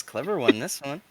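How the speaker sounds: soft sly mutter, fading out; snickering under breath. Acted. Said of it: "he snickers under his breath and he goes".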